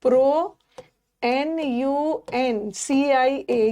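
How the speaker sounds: very slow letter-by-letter spelling of pronunciation; teacher slowly spelling pronunciation. Shown as a demonstration. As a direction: drag out each letter so students catch the spelling. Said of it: "ये spelling error में आता है".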